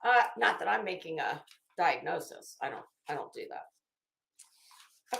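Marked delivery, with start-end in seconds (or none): 2.6-5.2 whispered aside. Real, not acted. Muttered aside to the audience.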